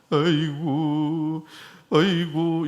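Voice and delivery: long drawn-out mourner's keen; imitated funeral wailing. Shown as a demonstration. Preacher showing old funeral custom, imitated slow wailing. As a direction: draw each word out like a ritual lament. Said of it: "요새는 장례식장에 가면".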